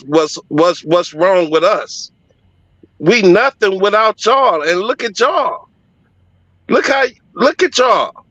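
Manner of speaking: imitating pleading church women. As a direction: beg them to see themselves. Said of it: "telling us they none without us".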